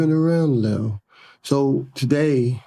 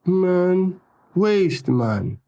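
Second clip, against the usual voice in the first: London slang; 7 semitones higher, much slower than usual. Retold or imitated. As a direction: throw it out like a London youth. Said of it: "just slang".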